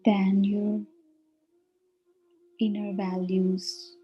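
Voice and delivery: measured meditation voice with long, spacious pauses; guided meditation. Real, not acted. Meditation leader guiding listeners on an inward journey, unhurried guiding voice. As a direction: guide them inward, phrase by phrase, leaving silence between.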